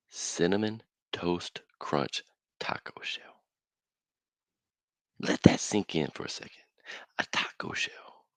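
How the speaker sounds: slow, breathy whisper for a big reveal; dramatic whisper. Real, not acted. Host unveiling the cinnamon taco shell, whispered. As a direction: hush it like a secret.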